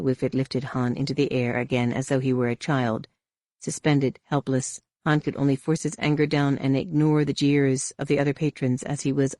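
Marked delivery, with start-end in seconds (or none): none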